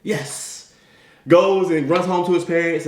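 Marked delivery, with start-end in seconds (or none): 0.0-0.7 whispered, excited yes. Acted. Timmy thinking he got the job, whispering excitedly. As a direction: celebrate under your breath, thrilled. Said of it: "he's just all excited".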